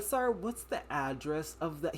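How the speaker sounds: a 911 dispatcher, too calm for a panicking caller, slow and soft; mock slow dispatcher. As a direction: imitate a dispatcher dragging his words. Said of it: "He's talking so slow".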